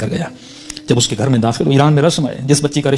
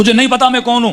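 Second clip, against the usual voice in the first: high, louder stern challenge; commanding challenge; 10 semitones higher, 4 dB louder than usual. Retold or imitated. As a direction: demand it sharply, voice up high. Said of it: "ایک جملہ کہا جس سے میرا وجود کانپ گیا". (بلند سخت للکار)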